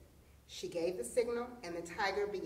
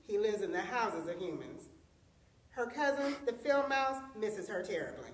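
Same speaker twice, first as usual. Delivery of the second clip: ordinary delivery, nothing marked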